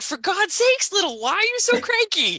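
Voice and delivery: high, loud exasperated mom; exasperated mother. Retold or imitated. Mother in picture book scolding cranky boy, loud and high. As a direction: huff at a cranky child. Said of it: "And she's like".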